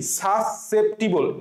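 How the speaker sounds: Susceptible stretched out very slowly, syllable by syllable; slow drawn-out pronunciation. Shown as a demonstration. Teacher slowly pronouncing susceptible for students. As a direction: say susceptible slowly so students can hear each part. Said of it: "টিবল, T I B L E".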